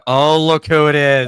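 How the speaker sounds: loud, drawn-out playful gush; long, loud fanboy greeting; hyped-up welcome. Pretend. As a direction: hail the arriving guest with big mock awe. Gushing welcome as guest arrives.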